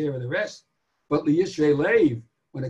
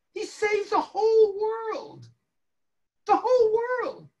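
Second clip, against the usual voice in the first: fervent preaching. Acted. Preaching at full force.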